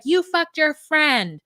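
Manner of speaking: high, slow mock accusation; acted accusation. Imitating girlfriend confronting her partner, high mock accusation.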